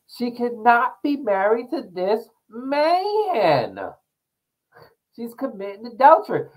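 mock-outraged pronouncement; quoting T S Madison. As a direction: proclaim it like a scandalized church lady. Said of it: "and it was like".

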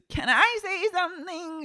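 high, put-on interjection; squeaky mock timid question; [name]. [name] interrupting outside, high put-on voice. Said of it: "[name] comes outside".

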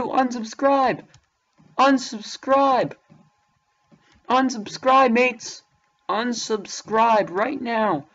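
slow, heavy mock-solemn order; slow drawn-out command. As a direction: drag out each unsubscribe slowly. Urging viewers to unsubscribe.

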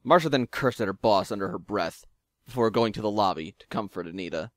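usual speaking voice

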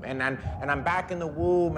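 ordinary talk, nothing marked

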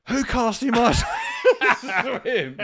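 loud high yell breaking into a laugh; shouted quote. Retold or imitated. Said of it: "He went".

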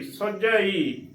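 slow ਸੁਝਈ ending in long ee; spelled-out reading. Shown as a demonstration. Teacher reading the spelled word, slow ਸੁਝਈ. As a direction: draw out ਸੁਝਈ, short u then long ee. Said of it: "ਸੱਸੇ ਔਂਕੜ ਸੁ".

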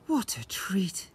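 slow contented whisper. A narrator's reading. Mother snuggles back into warm bed, whispered. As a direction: breathe it out happily, slow.

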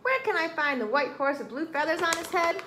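mild storybook shouting; screaming rooster. A narrator's reading. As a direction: demand an answer, still worked up. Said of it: "screamed Chicken Chuck".

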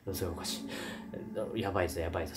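low, soft muttering; nervous under-the-breath mutter; hushed worry. Retold or imitated. Members muttering in fear.

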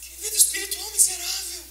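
mourning for the listener's empty faith; tearful plea. Retold or imitated. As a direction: plead with God through tears. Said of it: "comecei a chorar por você".

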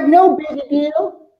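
high, slow smug voice; mock smug viewer, high and slow. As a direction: play a cocky user brushing it off. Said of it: "You're like".

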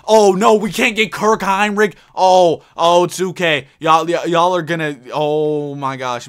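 loud exasperated yelling; real rage at the game; frustrated outburst. Real, not acted. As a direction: vent at the game, loud and fed up.

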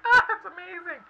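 high, loud squeal of delight; excited squeal. Retold or imitated. Reader thrilled by the tarot reading, squealing high and loud. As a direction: shriek it out like you can't contain yourself. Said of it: "I'm just like".

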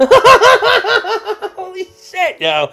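high, stretched laugh through the words; laughing disbelief. Real, not acted. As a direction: laugh helplessly at your luck. Laughing breakdown after the pull.

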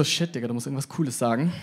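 quiet, hushed inner panic; panicked inner voice. Retold or imitated. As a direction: mutter it to yourself in panic. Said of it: "Und ich so". (leise, gehetzte innere Stimme)

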